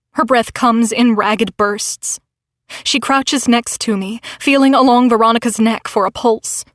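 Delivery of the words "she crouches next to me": ordinary delivery, nothing marked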